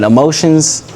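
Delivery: usual speaking voice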